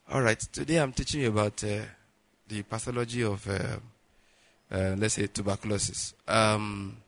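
low, soft lecture with no energy; drained lecturer struggling through the topic; tired from fasting. Acted. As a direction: teach feebly, searching for words. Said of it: "I can't talk very loud".

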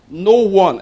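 No one thrown out for the room to repeat; calling out. Shown as a demonstration. Preacher prompting the congregation to say it back, loud. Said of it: "everybody said".

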